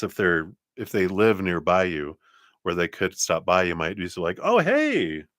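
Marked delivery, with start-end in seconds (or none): 4.4-5.2 high, singing mock-surprised hello; surprised greeting. Someone greets an unexpected visitor dropping by, mock surprise. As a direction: act pleasantly caught off guard, pitched high.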